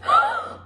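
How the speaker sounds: high startled intake of breath; astonished gasp; sharp gasp. A narrator's reading. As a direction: gasp at the sight above. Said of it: "he looked up and gasped".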